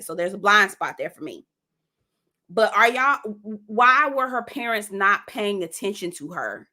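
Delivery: normal talking voice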